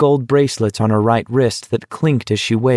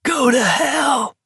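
very high, drawn-out scream; narrator's contained high scream; slower than usual. A narrator's reading. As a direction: scream slowly, strained and held back in volume. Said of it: "Olson screamed".

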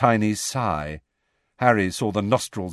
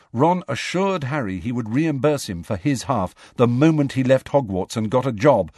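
ordinary delivery, nothing marked

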